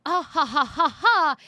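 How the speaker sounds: loud, spaced-out "ha ha"; mocking fake laugh. Podcaster voicing sexist online comments, mocking laugh. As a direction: imitate a sneering commenter's forced laughter. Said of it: "after I commented that were like".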